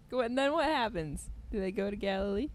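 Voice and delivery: ordinary talk, nothing marked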